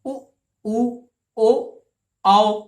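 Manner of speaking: उ, ओ, औ with lips rounded, each held; rounded vowels demo. Shown as a demonstration. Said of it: "इनको बोल के देखिए". (होंठ गोल करके स्वरों का उच्चारण)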